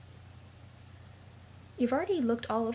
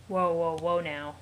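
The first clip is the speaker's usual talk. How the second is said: ordinary delivery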